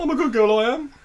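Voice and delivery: put-on high, pleading girlish voice; mock little girl. Still in the film role, a high girlish plea. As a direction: play a small girl insisting she's good. Continues victim voice.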